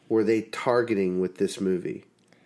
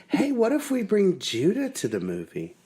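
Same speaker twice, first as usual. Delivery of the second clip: higher-pitched quoted wife; imitated wife's suggestion; 10 semitones higher than usual. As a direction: pitch it up like an eager proposal. Retells wife's suggestion in her voice.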